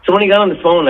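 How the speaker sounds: ordinary delivery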